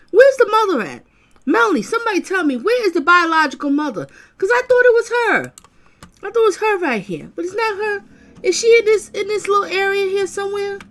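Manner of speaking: hunting the screen for her; eager searching. Real, not acted.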